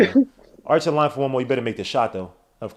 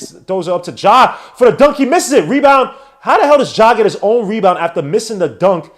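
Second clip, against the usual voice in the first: high, loud, incredulous call; heated play-by-play; 7 semitones higher, 9 dB louder than usual. Real, not acted. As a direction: shout the play in disbelief. Live call of missed dunk, rebound.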